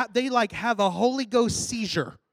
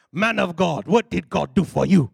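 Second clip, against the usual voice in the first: lower, imitated African preacher voice; African pastor; 4 semitones lower, 5 dB louder than usual. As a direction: question him like an African revival preacher. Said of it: "Big old African guy".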